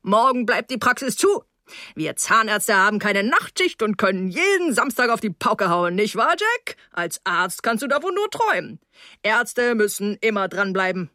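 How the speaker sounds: put-on hearty host voice with big upward swoops; jovial tipsy dentist. A narrator's reading. Dentist teasing a young would-be doctor at dinner, jovial. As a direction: play the red-faced dinner host holding forth.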